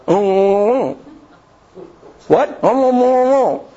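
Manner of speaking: high, strained oh sounds of a man who cannot speak; mock mute straining to speak; mute grunting. Mute Zachary tries to speak.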